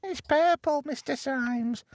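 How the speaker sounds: put-on very high young boy voice; a squeaky little lad reporting back. Boy Neville answering Symes.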